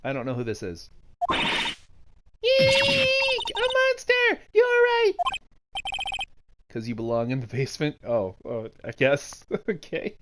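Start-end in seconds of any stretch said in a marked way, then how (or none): 1.3-5.1 much higher and louder, hammed-up scream; mock frightened shriek. Girl sees a monster, shrieking.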